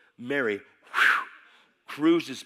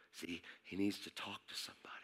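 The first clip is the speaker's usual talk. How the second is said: whispered confidingly; 6 semitones lower, 17 dB quieter, faster than usual. Real, not acted. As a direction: lean in and whisper it. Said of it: "whispered aside in sermon".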